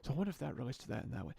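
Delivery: fast whispered musing to himself; subvocal whisper. Retold or imitated. Student musing to himself in class, quick whisper. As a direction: murmur the thought under your breath, quick. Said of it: "I'll just be like".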